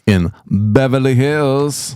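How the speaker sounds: high, slow and dreamy; dreamy drawl. Pretend. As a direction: swoon over the name. Dreamy drawn-out place name.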